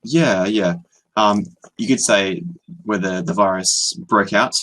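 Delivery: ordinary talk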